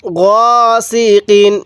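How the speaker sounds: গসিকিন read very slowly, syllable by syllable; slow recitation. Shown as a demonstration. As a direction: draw out গসিকিন carefully. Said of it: "তাহলে কিভাবে পড়ব".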